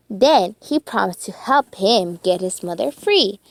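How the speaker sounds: plain reading voice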